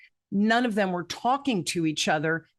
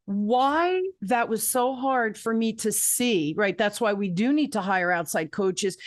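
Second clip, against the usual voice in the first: ordinary delivery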